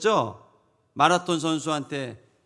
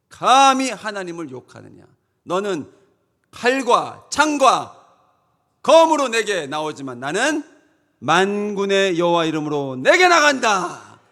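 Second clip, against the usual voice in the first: loud, high defiant declaration; David's bold proclamation; 4 semitones higher, 10 dB louder than usual. Acted. David facing Goliath in God's name, defiant shout. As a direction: thunder it out as a challenge to the enemy. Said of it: "하나님 살아계심을 말하잖아요".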